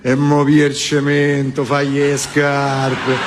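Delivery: rattled-off Roman orders; mock Roman gangster; Roman accent. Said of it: "A uno del Roma".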